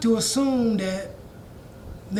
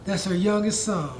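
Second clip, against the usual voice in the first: ordinary talk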